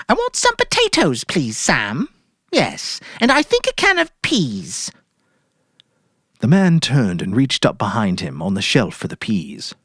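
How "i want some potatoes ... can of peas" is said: much higher, airy put-on cheer; forced cheerful wife. Mary ordering groceries from Sam, falsely cheerful. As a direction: play it breezy to hide guilt.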